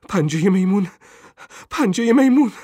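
terrified scream. A narrator's reading. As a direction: cry out in alarm, pitch climbing.

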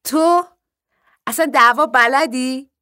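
high, loud incredulous teasing; amused mocking disbelief. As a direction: tease as if cracking up at the boast. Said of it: "ریسه رفت. بعد گفت".

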